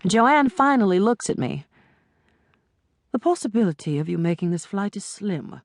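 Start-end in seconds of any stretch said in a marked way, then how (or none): 3.1-5.6 put-on cold British accent. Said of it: "in a cold British accent".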